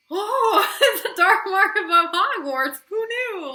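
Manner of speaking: mock yourself for missing the obvious; sarcastic laughing. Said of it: "look at the back of the book".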